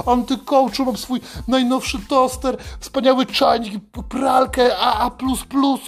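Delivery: high, smug and gushing; mock a self-satisfied eco-conscious guy bragging about his appliances; smug eco-lover. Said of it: "nie, mówisz".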